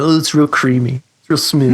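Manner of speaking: a hint of a gear enthusiast, mocked; mock tone snob. Pedal builder mimics people talking about their tone, lightly mocking. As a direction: lightly imitate someone gushing about guitar tone. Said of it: "I hate it when people talk about their tone".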